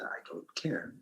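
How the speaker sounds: hushed, toneless near-whisper; not caring. Retold or imitated. Actor recalling his audition mindset, whispered indifference. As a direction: whisper it as a dismissive inner thought. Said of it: "space where I just didn't sort of care".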